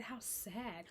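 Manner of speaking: ordinary talk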